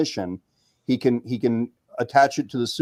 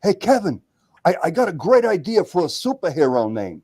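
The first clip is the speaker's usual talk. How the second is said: imitating an excited friend, pitched higher; excited buddy; 4 semitones higher than usual. Buddy runs up to Kevin with a superhero name idea, excited. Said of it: "he goes to me".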